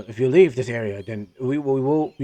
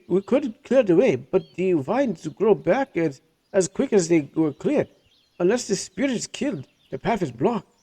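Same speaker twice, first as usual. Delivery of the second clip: higher, hushed awe at the spirit; higher merchant voice; 7 semitones higher than usual. Acted. Jafar tells the party about the forest spirit, higher voice. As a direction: describe the sleeping spirit with wonder.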